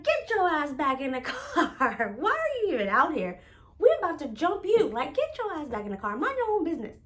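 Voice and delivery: high, loud mock threats with a laugh; yelling at the nosy stranger to go back, loud and laughing; laughing threat shout. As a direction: bark them back into the car, half laughing. They yell threats at the stranger.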